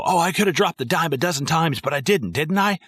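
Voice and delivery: a hint of a wheedling gangster; Maury impression. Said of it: "when Maury's like".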